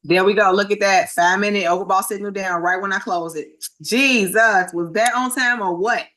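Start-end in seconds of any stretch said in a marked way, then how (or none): 3.8-6.1 surprised. Real, not acted. Signal pops right at close.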